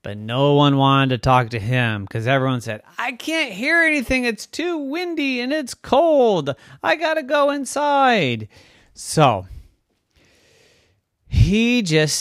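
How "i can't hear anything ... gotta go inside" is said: calling over wind. Acted. Townsfolk complaining to the wind, high-pitched wail.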